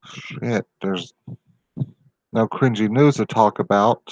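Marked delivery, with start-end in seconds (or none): none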